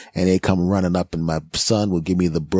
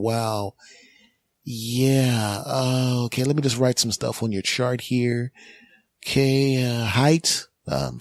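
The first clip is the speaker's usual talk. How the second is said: sarcastic paramedic; 5 semitones higher, 3 dB quieter than usual. Acted. Paramedic filling chart, mocking.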